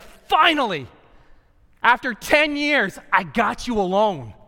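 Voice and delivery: loud, high, drawn-out cry of finally; exclaimed relief. Acted. God finally getting you alone to talk, exclaimed relief.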